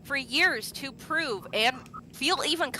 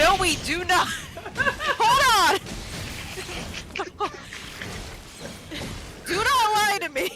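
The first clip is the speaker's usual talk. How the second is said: laughing disbelief; 7 semitones higher than usual. Retold or imitated. Denying father's resemblance while laughing.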